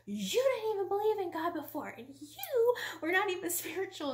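re-enacted yelling at a husband; yelling accusation. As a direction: hurl it at him, high and indignant. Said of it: "yelling at him, basically".

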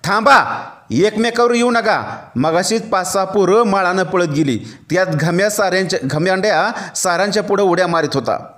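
plain reading voice

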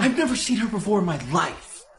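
nervous denial. Acted. Boyfriend denies her to boss.

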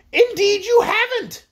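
high, slow, outraged contradiction; sharp royal retort. A narrator's reading. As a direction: contradict him from the top of your range. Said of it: "retorted the Queen".

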